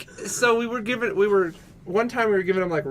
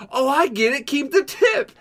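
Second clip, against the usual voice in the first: high, excited imitation of finally getting it; mock delayed realization; 8 semitones higher than usual. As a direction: Keep the tip squeaked up high in mock discovery. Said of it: "And he's like".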